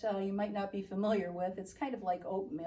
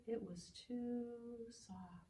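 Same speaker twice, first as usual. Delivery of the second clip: very soft; 11 dB quieter, slower than usual. Shown as a demonstration. Storyteller softens her voice to show the opposite of hard. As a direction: let it fade almost to nothing. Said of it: "the opposite of hard".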